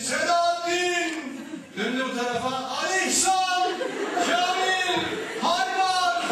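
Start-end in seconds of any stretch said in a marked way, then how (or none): 0.0-1.1 high, loud, drawn-out shout of a name; calling out. Retold or imitated. Trainee finally shouting names from the overpass steps, calling out. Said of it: "Bağırıyor". 2.5-6.3 high, sustained shouts of names; calling out. Retold or imitated. Carried-away trainee calling one name after another, loud shouting. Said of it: "Bağırıyor".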